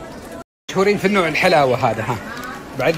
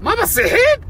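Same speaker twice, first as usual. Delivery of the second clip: high, loud mock-incredulous question; teasing disbelief; 4 dB louder than usual. Real, not acted. Teasing his mother at the farewell, high mock disbelief. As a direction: tease her as if shocked she won't cry.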